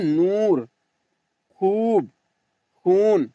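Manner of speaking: long drawn-out oo in "नूर, खूब, खून"; slow teacher's "नूर" with a stretched oo; stretched waw. Shown as a demonstration. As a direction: hold the oo in each word, "खूब". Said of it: "खींच कर पढ़ा जा रहा है".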